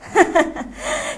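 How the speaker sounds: breathy little giggle; mouse giggle. Acted. The mouse giggling after the snake flees.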